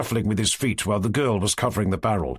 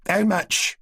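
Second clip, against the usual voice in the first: abrupt; 9 semitones higher than usual. A narrator's reading. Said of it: "asked the old woman abruptly".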